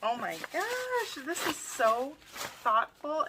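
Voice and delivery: high, drawn-out gushing; touched delight. Real, not acted. Seeing a gift made just for her, gushing delight.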